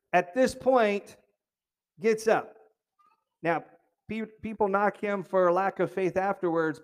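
ordinary delivery

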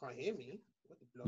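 very faint voice down a bad line; barely audible, far from the mic; whispering on the phone. Real, not acted. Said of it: "it's like you're whispering and that".

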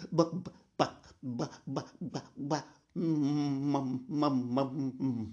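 normal talking voice

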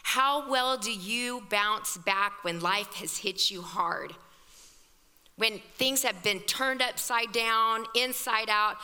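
ordinary talk

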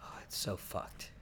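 breathy whisper of disbelief. Real, not acted. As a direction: breathe it out under your breath. Stunned reaction to the question.

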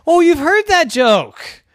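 high, slow, let-down pig voice; Dora disappointed. Acted. Dora to the hen, high and let down. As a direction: deflate as the joke flops.

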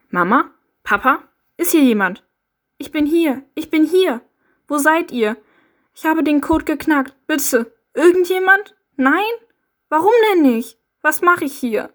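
high, loud calls into the empty ruins; desperate calling. Acted. Calling for Mama and Papa at the destroyed house, desperate loud cries. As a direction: cry out for your parents in despair. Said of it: "Verzweifelt brichst du schreiend zusammen".

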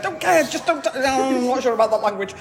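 high, fast, flustered brush-off; flustered refusal. Retold or imitated. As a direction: babble a nervous refusal, fast. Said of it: "And you're like".

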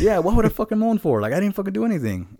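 very high, squeaky incredulous voice; outraged disbelief. Retold or imitated. Denying the moan to coworker, very high outraged squeak. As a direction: screech your disbelief. Retold argument about moan.

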